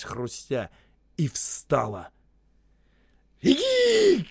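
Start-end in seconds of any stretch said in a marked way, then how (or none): 3.4-4.3 very high, loud furious yell; furious shout. A narrator's reading. Osip furiously yelling at the men to run. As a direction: scream the order in fury. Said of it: "яростно закричал Осип".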